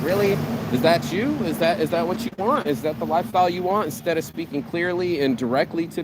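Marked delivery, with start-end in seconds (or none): 0.0-3.9 high, disbelieving questions; confronting someone about the lifestyle they choose, incredulous; incredulous mock challenge. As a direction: needle them with disbelief. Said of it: "you're just like".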